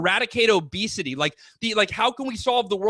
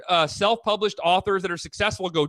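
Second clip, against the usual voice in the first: normal talking voice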